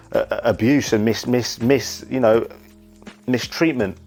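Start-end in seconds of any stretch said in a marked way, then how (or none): none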